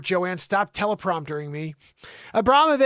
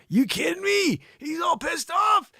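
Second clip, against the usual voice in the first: incredulous shout; 10 semitones higher than usual. Real, not acted. Host yells in disbelief at the grumpy oligarch's photo. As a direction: yell it in mock outrage.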